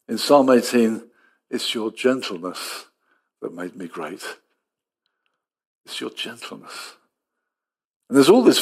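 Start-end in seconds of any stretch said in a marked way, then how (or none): 1.5-7.0 hushed. Acted. Quoting psalm about gentleness.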